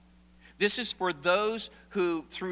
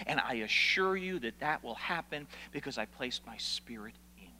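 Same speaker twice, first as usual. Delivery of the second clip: whispered promise; 5 semitones lower, 6 dB quieter than usual. Acted. Intimate promise voiced as God.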